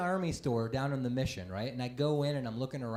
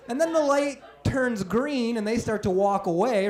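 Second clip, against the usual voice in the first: ordinary talk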